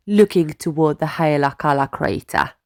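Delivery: ordinary delivery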